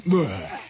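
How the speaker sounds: short, loud throaty bleh; imitated turtle bleh. Storyteller voicing the turtle floating beside him, throaty bleh. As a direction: gag out a lazy turtle noise. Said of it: "once in a while going".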